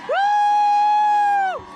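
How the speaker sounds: high loud cheering woo; rally whoop. Real, not acted. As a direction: whoop up the crowd.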